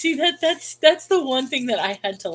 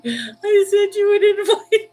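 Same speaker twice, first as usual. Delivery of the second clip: high, slow, laughing through the words; laughing quote; 12 semitones higher, 8 dB louder, slower than usual. Retold or imitated. Retelling talk with Misty, a high laughing quote. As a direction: laugh while quoting yourself. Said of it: "I was like".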